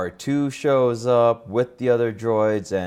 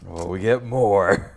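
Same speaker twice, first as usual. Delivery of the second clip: gruff action hero voice; slower than usual. Retold or imitated. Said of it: "giving Plo my gruff, like, eighties action hero voice".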